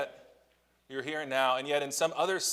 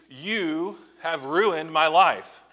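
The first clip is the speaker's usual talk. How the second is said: put-on teen accusing parent; 5 semitones higher, 6 dB louder, much slower than usual. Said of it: "directed at you".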